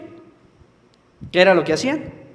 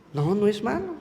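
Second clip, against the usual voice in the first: usual speaking voice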